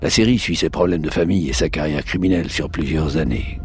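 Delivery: deep, dramatic trailer announcer; low epic trailer narration, dead serious; movie trailer voice. Shown as a demonstration. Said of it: "pour utiliser ma voix de trailer".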